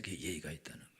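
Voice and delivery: fast, hushed aside; 빠르게 속삭이듯 덧붙이기; whispered aside. Real, not acted. Aside on proper manners. (빠른 속삭임)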